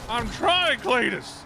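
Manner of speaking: high, loud stressed yell; panicked shout. Real, not acted. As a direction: shout it while fleeing in panic. Fleeing monster in game.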